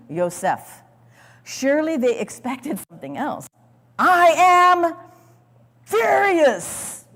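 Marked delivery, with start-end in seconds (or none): 4.0-7.1 high, loud furious outburst. Pretend. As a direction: yell it in sudden fury. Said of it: "you know, maybe".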